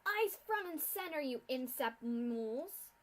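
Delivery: shouted order. Acted. Suited guard pony shouts at the new ex-students, loud barked order. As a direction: bark it at the recruits like a drill sergeant. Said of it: "one of the suited ponies shouted".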